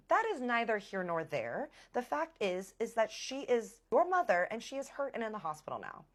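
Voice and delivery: indignant rebuttal. Retold or imitated. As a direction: press the point in acted exasperation. Acted rebuttal in quarrel.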